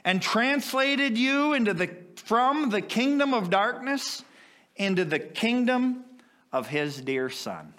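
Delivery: high, ringing pulpit preaching. Acted. Preacher proclaiming to the congregation, ringing.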